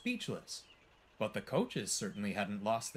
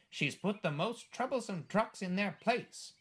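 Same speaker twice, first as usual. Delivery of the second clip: higher, brisk comeback; retort; 9 semitones higher than usual. A narrator's reading. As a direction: answer back in her defence. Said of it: "retorted Henrietta".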